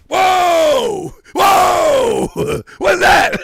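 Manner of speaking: high, loud mock astonishment; hammed-up shocked yell breaking into laughter; shocked whoa. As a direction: shout it in exaggerated disbelief. Acting shock at the switch.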